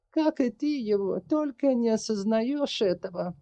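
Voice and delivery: put-on high, measured voice of an old witch. A narrator's reading. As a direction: voice the sorceress, calm and heavy.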